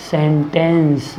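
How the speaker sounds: Sentence stretched into two long syllables; slow dictation. Shown as a demonstration. Teacher giving the English word for vakya, slow dictation. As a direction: say sentence at half speed, sen-tens.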